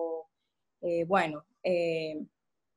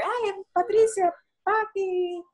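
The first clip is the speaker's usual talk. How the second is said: high, excited call of her name; imitating friends calling; 11 semitones higher, 3 dB louder, slower than usual. Recalling how friends greet her, a high mock call.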